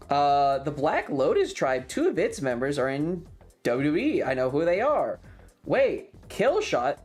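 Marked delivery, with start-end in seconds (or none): none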